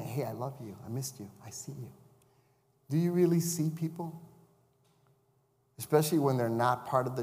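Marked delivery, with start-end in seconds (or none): 0.0-1.9 tender greeting. Retold or imitated. Avatar greeting quoted.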